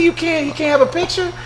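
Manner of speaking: high, soft disbelieving question; incredulous question. Retold or imitated. Host challenging the security guard, high and incredulous. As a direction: ask it as if you can't believe it. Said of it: "and I said".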